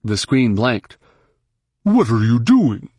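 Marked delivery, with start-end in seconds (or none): 1.8-2.9 mild sharp demand in character; indignant question, lightly acted. A narrator's reading. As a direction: demand an explanation, pitch jumping on key words. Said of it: "Rhonda demanded".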